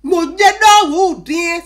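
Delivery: loud, slow drawn-out cry; 「non」を長く伸ばして大声で叫ぶ; original exclamation. Shown as a demonstration. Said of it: "Si je vais traduire de façon terre à terre".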